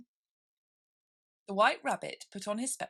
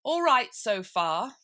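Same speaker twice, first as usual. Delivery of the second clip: loud, slow satisfied king; pleased king; 5 semitones higher, 9 dB louder, slower than usual. Acted. King pleased with his reasoning, loud and slow.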